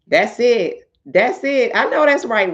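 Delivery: high, loud whoops of approval; excited cheering. Real, not acted. Hyping up her friend's comment, high and loud. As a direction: cheer her on like a hype woman.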